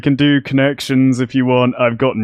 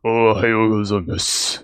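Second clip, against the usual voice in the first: lower, thick mock Japanese accent; 4 semitones lower, 3 dB quieter than usual. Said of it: "doing a racist Japanese accent".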